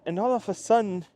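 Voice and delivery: ordinary talk, nothing marked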